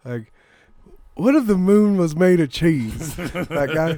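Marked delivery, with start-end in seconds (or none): 1.1-3.1 loud, slow goofy shouting; Will Ferrell bit. Retold or imitated. Mocking the Will Ferrell type to his friend, slow loud goof. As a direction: belt it like a clueless comedy dude. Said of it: "version of the Will Ferrell character".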